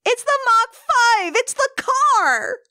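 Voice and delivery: very high, excited squeal; thrilled exclamation. Acted. Kid seeing the Mach five, thrilled high squeal. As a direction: gush like a starstruck kid. Acts out awe at car.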